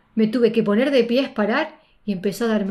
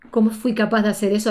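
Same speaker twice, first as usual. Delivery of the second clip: usual speaking voice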